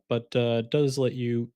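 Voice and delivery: normal talking voice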